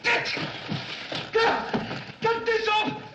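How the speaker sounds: high, loud choking cry; sputtering shout. Acted. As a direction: yell to get the fumes off. Sputtering, salts too strong.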